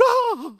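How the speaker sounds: high, loud "Ah!"; mock shocked gasp-scream. Mom reacting to her son's remark, mock shocked shriek. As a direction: shriek like a mom about to faint, played for laughs.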